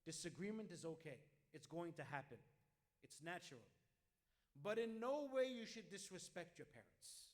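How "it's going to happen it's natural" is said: ordinary talk, nothing marked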